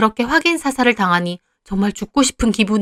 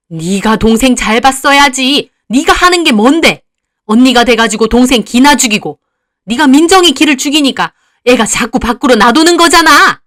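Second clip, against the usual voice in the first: loud, higher scolding yell; angry mother; 4 semitones higher, 8 dB louder than usual. Acted. Drunk mother blaming her daughter for the sister, yelling. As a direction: blame her furiously.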